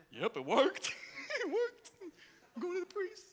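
high, far off voice of a delighted leper; lightly voiced giddy leper; excited healed man. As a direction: act the overjoyed healed man.

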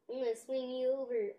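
usual speaking voice

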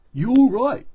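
loud, high, beefy hero voice; big friendly praise from a tough guy; hearty action hero. Acted. Action Man cheering up Doctor Z, hearty and loud.

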